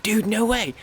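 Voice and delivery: high-pitched thrilled reaction; excited disbelief. Retold or imitated. Streamer reliving a chat surprise, excited disbelief. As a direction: jump up high in delighted disbelief. Acting out joy at chat message.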